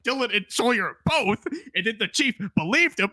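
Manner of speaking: usual speaking voice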